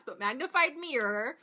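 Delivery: "magnified" stressed, rising as a correction. Shown as a demonstration. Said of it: "not magnifying glass".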